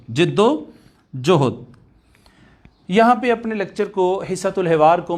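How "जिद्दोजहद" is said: जिद्दोजहद low and stretched out slowly; slow closing drill. Shown as a demonstration. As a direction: lay जिद्दोजहद down slow and deliberate. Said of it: "आज के बाद यह है जिद्दोजहद".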